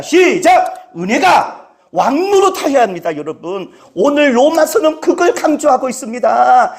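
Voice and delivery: ordinary delivery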